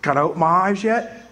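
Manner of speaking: normal talking voice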